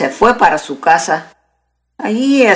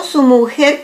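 plain reading voice

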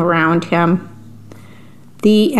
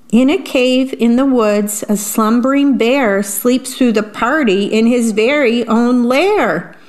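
usual speaking voice

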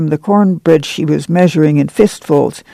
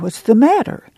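high-pitched demanding question; startled-awake demand; 7 semitones higher than usual. A narrator's reading. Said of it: "Pa demanded".

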